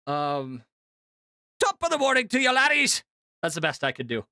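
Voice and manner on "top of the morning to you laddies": put-on higher, louder Irish accent greeting; a bright, loud mock Irish hello; Jacksepticeye Irish accent. Doing a Jacksepticeye impression for chat in a loud Irish accent. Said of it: "Can I do Jacksepticeye?".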